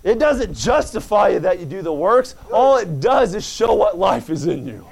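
fired-up preaching. Acted. Pastor exhorts the church about faith, fired-up preaching. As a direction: drive it home to the congregation.

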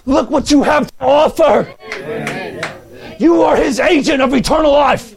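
high-pitched, fervent pulpit cry; fired-up revival preacher; impassioned preaching. Real, not acted. Preaches at full force.

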